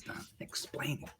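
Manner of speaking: hushed whisper aside. Acted. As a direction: whisper the prompt urgently. Urging aside to Dan.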